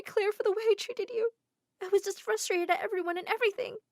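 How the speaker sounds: high, strained voice breaking into sobs; tearful confession. Acted. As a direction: apologise until the tears take over. Hanny confessing, then crying.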